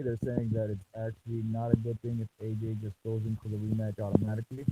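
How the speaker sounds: muffled, like through a wall. Real, not acted. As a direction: speak with the mic covered. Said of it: "you sound muffled".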